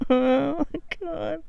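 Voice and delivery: high, slow half-laugh half-sob; real frustrated whimper breaking into a laugh; laugh-crying. Real, not acted. Gamer stuck on a puzzle, laughing on the edge of tears. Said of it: "I'm gonna start crying".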